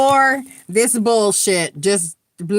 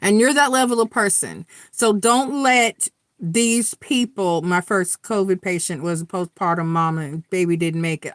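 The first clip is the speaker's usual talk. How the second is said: usual speaking voice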